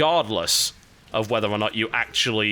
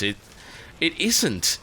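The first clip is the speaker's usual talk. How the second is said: ordinary talk, nothing marked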